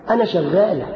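ordinary talk

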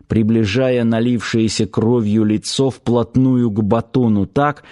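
normal talking voice

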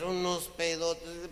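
ordinary talk, nothing marked

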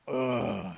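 loud groan of strain; effortful groan. Acted. Squashed Possum getting up, groaning. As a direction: groan as you haul yourself up. Possum struggles up after healing.

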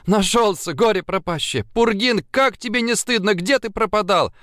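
put-on high, bubbly girlish joy; squealing with joy. A narrator's reading. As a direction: gush with delight, voice up high. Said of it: "завизжала от радости".

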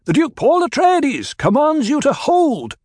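high, loud proclamation of rank; ducal command. A narrator's reading. Paul ordering the fighters to hold, loud command. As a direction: ring out his title to stop them.

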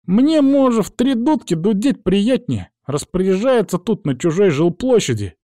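high-pitched indignant woman; bristling. A narrator's reading. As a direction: scold him in a put-on high voice. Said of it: "вдруг взъерепенилась прелестная девица".

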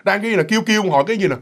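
usual speaking voice

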